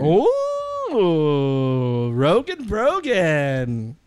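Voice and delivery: stretched slowly, singsong; drawn-out teasing singsong. Pretend. Host teases co-host by stretching the nickname slowly. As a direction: drag out the rhyme to tease.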